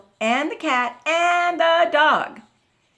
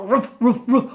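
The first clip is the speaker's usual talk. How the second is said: short, loud woofs; dog barking; 6 dB louder than usual. A narrator's reading. Dog joins the noisy animals, loud woofs. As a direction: bark in quick sharp bursts. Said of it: "each of the animals joined in".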